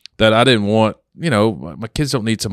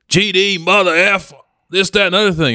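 cursing guy; 11 semitones higher than usual. Retold or imitated. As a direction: imitate a guy swearing at the pump. Angry guy cursing at gas pump.

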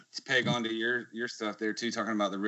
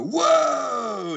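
high, loud playful whoa; dad hyping up his jumping son; 13 semitones higher, 6 dB louder than usual. Retold or imitated. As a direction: cheer the toddler on. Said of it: "And I'll go".

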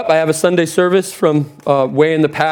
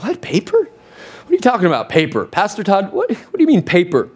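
higher-pitched, baffled questioning; mock bafflement; 3 semitones higher than usual. Imagined listener to Pastor Todd about paper, mock bafflement. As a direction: question it as if it makes no sense.

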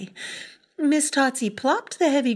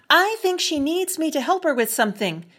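high, loud, fast call upward; yelled up the stairs; 7 semitones higher, 5 dB louder, faster than usual. Acted. Matilda yelling her excuse up the stairs to mother. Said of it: "she yelled up the stairs".

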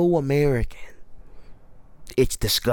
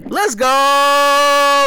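high, loud hype; proud brother cheering loudly; 12 semitones higher, 8 dB louder than usual. Real, not acted. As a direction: celebrate the win. Cheering sister's gig.

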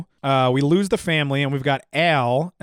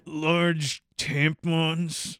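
talking through gritted teeth; mumbled through clenched teeth; 7 dB quieter, much slower than usual. Acted. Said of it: "Al grits his teeth".

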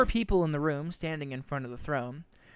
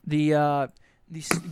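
ordinary talk, nothing marked

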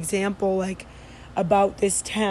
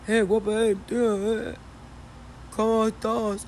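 softer, mumbled Andalusian "Hey, guapa"; half-hearted southern catcall; 4 dB quieter than usual. Retold or imitated. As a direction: try the slurred version again and give up. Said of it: "I can't do it".

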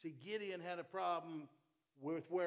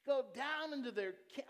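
higher and louder, delighted; excited repeat; 9 semitones higher, 6 dB louder than usual. Acted. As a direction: relish the line. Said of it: "I love that part".

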